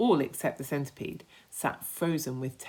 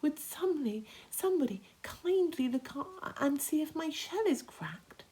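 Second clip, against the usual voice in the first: higher, gentle ladybird; 13 semitones higher, 4 dB quieter than usual. Acted. Ladybird asking the others to check her shell, higher. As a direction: ask politely and worried. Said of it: "the ladybird said".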